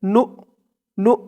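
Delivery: নু said alone, clipped; syllable drill of নু; isolated syllable. Shown as a demonstration. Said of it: "এখানে উচ্চারণটা হবে".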